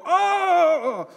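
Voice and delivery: sudden high, loud startled cry; flustered yelp. Retold or imitated. New dad caught off guard by the baby, startled yelp. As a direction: yelp as the baby pees everywhere. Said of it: "Now I'm all flustered".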